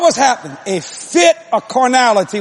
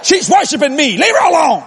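raised, emphatic command; stern rebuke; 5 semitones higher, 3 dB louder than usual. Retold or imitated. Preacher voices God defending his wife, raised stern rebuke. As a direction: order him off firmly. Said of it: "He said".

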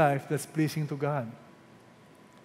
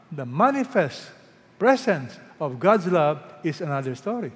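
usual speaking voice

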